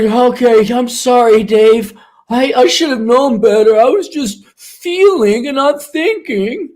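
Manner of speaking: meek apology. Pretend. Client apologising to Dave after the kick, meek. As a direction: sound small and contrite.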